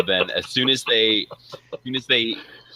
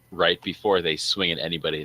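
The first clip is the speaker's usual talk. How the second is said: ordinary talk, nothing marked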